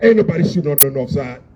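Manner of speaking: ordinary talk, nothing marked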